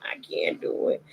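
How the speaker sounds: normal talking voice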